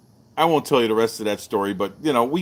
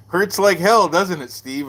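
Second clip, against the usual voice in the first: higher and louder ribbing; teasing; 7 semitones higher, 3 dB louder, slower than usual. Retold or imitated. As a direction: needle a bruised student. Voicing Bill teasing him.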